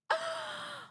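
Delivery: quick startled gasp; sharp gasp. Real, not acted. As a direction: gasp in surprise. Sudden shock at game.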